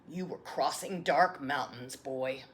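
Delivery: a patient grandfather answering; grandfather's voice. Acted. Grandfather replies.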